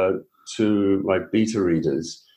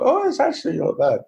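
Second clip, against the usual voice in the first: pitched-up, quick pleased surprise; retold surprise; 12 semitones higher, faster than usual. Reader surprised by her own recording, mock amazement. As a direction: replay your own delighted double take. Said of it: "The surprise and was genuine".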